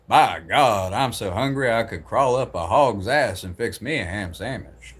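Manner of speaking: hammed-up Southern twang with swooping pitch; Southern American accent. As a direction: imitate a country Southerner's colorful saying. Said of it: "sayings from, like, Southern people".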